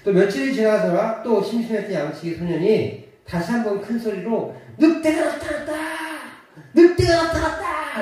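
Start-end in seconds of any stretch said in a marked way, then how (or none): 4.8-8.0 high, loud teasing cry; playful shout. Acted. Shepherd boy fooling the village again, teasing shout. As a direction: yell it out for fun again. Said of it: "라고 소리를 질렀습니다".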